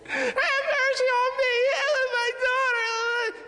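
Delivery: shrill-sounding mock wail of a desperate mother; grating pleading woman. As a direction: whine it out like a voice that wears everyone out. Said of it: "I don't even like her voice".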